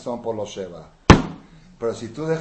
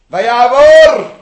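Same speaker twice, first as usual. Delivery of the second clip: loud, much higher long call; exclaimed cry; 14 semitones higher, 24 dB louder, much slower than usual. Acted. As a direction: ring it out to the hall. Preacher cries out.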